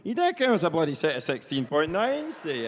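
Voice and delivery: loud, high Perthshire farmer addressing a computer; Willie. Retold or imitated. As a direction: hail the machine like a person, loudly. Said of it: "as if he was, eh, speaking to the computer".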